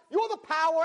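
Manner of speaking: ordinary delivery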